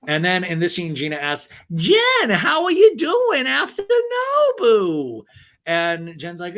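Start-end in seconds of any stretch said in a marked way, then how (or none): 1.7-5.2 very high, loud caricature; hammed-up squawked question, pitched way up; mock wailing friend. Gina asking Jen about Nobu, mock wailing voice.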